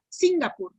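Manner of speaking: "Singapur" said as SIN-ga-pur, SIN high; stress wrongly on SIN. Shown as a demonstration. Teacher showing why a guess is wrong, "Singapur" stressed on SIN. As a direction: hit the first syllable of "Singapur". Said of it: "Sin sería".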